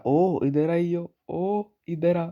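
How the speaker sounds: high, loud drawn-out call. Retold or imitated. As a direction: shout across to the child named O. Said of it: "तुम्हें कोई बुला रहा है". (ओ इधर आ, ऊँची आवाज़ में पुकार)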